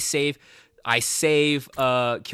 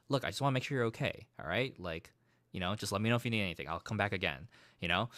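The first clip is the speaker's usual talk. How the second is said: soft reassurance; 5 semitones lower, 9 dB quieter, faster than usual. Retold or imitated. Takumi reassuring quarantined Kurara.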